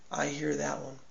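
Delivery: low, hushed reply; quiet divine answer. Acted. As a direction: answer softly and low, as God hearing a plea. Voices God answering the faster.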